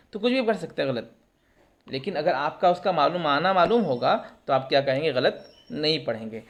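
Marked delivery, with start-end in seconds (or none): none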